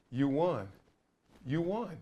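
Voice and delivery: soft, weighted repetition after pauses; hushed, moving quote. Retold or imitated. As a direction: repeat it very softly, letting it sink in. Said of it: "these two words that brought me to tears".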